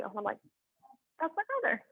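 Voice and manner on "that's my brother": fond aside. Retold or imitated. Fond reaction to brother.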